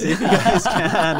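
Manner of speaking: high, loud, slow amused request; laughing plea. Retold or imitated. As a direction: plead through laughter. Retold pleading through laughter.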